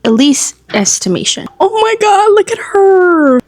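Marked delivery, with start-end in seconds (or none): 1.6-3.4 much higher, louder burst of disbelief; frantic squeal. Real, not acted. Reader shrieking at her camera over the cliffhanger, frantic squeal. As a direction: squeal it at the screen, beside yourself.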